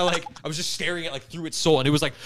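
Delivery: ordinary talk, nothing marked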